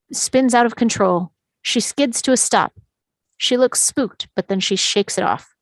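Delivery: normal talking voice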